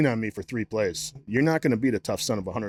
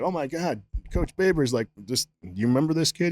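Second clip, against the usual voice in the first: higher, excited gushing; excited coach; 6 semitones higher than usual. Retold or imitated. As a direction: gush about the old player. Baylor coach quoted excitedly.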